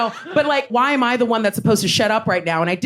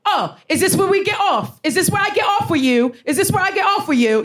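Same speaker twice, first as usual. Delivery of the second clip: high, mocking drunk sing-out; drunk heckler; 11 semitones higher than usual. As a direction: imitate a drunk man pestering from behind.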